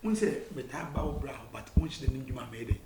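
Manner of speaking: ordinary talk, nothing marked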